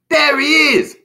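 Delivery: high, loud shout of discovery. A narrator's reading. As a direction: shout as you spot the intruder. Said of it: "shouted one".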